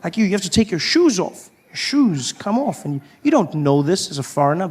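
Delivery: normal talking voice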